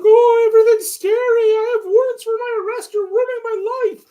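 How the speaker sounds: very high, loud whiny mock voice; mocking a thief. Mocking the shoplifter's excuses, shrill whiny impression. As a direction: whine it like a pathetic crook. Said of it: "He's like".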